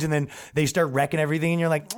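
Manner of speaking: normal talking voice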